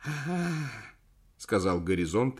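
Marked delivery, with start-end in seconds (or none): 0.0-0.7 put-on slurred frail old man; barely moving his tongue. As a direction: mumble with a lazy tongue. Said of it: "еле ворочая языком".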